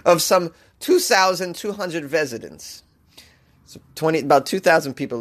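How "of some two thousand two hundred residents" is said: slow, hammed-up German-accented read; clumsy German accent; bad German accent. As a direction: finish the report in a fake German accent. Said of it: "with a German accent".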